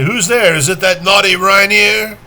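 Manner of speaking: loud, high mock-scolding mother; calling mother. Mother answers her son's knock at the door, loud playful scolding call. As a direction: call out sharply to a child behind the door.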